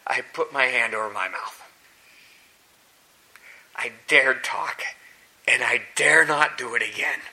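low, soft and hushed; awed humble Job. Acted. Job, quivering, answering God: low humble hush. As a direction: speak small before overwhelming power.

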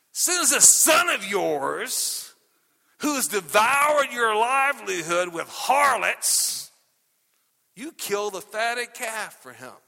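slow, loud, sneering stress on this son of yours; indignant older son. Retold or imitated. Older son accusing father of favoring his brother. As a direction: drag out the accusation.

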